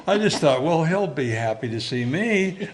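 laughing through the words; amused at his own naivety, laughing. Real, not acted. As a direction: chuckle at your younger self.

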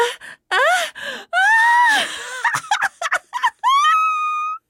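very high, loud, wavering cry; long wobbling squeal of effort; cartoon whoop, held and shaken; straining flight wail. A narrator's reading. Turtle straining to fly.

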